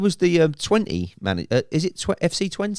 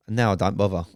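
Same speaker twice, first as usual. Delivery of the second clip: usual speaking voice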